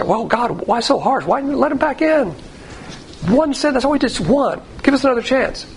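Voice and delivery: high-pitched imitation of people pleading; acted-out objectors, pitched well up; mock protesting plea. Voices people pleading with God.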